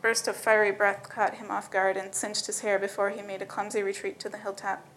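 ordinary delivery

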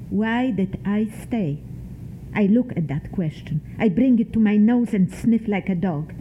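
ordinary delivery

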